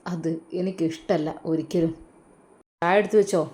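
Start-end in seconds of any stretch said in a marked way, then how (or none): 2.8-3.4 curt order, a bit louder; husband demanding his tea, commanding. Acted. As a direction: bark the order at his wife. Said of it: "അയാൾ ആജ്ഞാപിക്കുന്നു".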